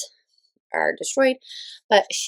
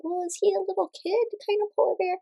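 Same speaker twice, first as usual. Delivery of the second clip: put-on much higher, childlike question; mock little-kid voice, wondering; imitating a little kid; 12 semitones higher than usual. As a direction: ask it like a curious small child. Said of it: "my kids are like".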